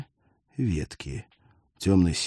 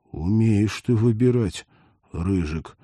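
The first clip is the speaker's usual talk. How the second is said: plain reading voice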